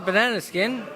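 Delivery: ordinary talk, nothing marked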